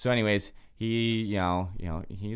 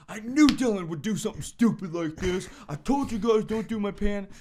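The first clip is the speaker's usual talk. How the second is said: higher, louder mock whine; mimicking ranting roommate; 13 semitones higher, 6 dB louder than usual. Roommate ranting that Dylan ruined his pan, higher mock whine. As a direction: whine it like a wronged pan owner.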